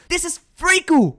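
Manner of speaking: high-pitched comic yell; mock-outraged shout. Host exposing Miku as Friku, mock-outraged shout.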